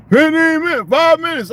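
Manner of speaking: high, loud mock of a pushy coworker. Mocking coworker rushing him.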